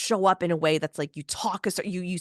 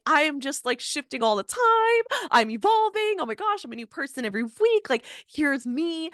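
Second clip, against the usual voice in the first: giddy self; 7 semitones higher than usual. Retold or imitated.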